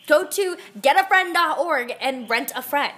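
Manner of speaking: ordinary delivery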